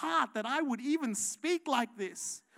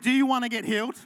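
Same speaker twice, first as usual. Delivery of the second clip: louder, brisk, mock dismissal; mock blunt pastor; 9 dB louder, faster than usual. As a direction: play a pastor shooing people out. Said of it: "Can you imagine if we started".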